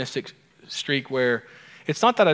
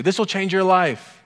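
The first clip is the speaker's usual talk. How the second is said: mock grand pronouncement, higher and louder; jokingly imitating; 6 semitones higher, 4 dB louder than usual. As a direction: play up the other pastor's catchphrase. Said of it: "who would jokingly say".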